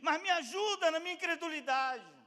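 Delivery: high, loud cry for help; shouted prayer. Acted. Believer begging God to help unbelief, crying out. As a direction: shout your plea up to God. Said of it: "Gritar é falar".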